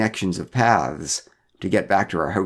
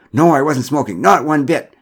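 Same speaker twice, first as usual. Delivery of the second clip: higher, louder insistent denial; emphatic protest; 6 semitones higher, 7 dB louder than usual. A narrator's reading. Derek keeps denying he smoked, loud protest. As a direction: insist on every word of the denial. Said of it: "protested Derek".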